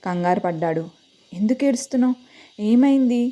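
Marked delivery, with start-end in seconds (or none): 1.3-3.2 worried questions, higher and louder; anxious. A narrator's reading. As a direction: ask in alarm. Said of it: "అని కంగారుగా అడిగాడు".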